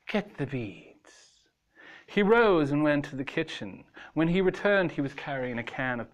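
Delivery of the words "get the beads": soft whispered order; hushed. A narrator's reading. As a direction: whisper the command. Hushed command.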